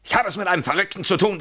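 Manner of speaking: high, loud, fast, a disbelieving laugh breaking in; rising, fast, near-hysterical protest; exasperated outburst. Acted. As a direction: burst out at the absurd accusation. Heated row, accused of crimes.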